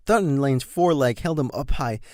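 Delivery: plain reading voice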